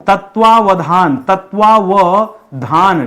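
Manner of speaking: तत्वावधान said very slowly, syllable by syllable; slow spelling demo. Shown as a demonstration. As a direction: drag out तत्वावधान for the class. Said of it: "teacher spells word out slowly". (धीरे-धीरे एक-एक अक्षर पर ज़ोर)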